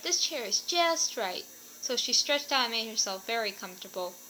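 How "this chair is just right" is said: higher and slow, lightly delighted; pleased. A narrator's reading. Goldilocks settling into the small chair, slow and delighted. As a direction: savour it, happy with the chair. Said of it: "she cried".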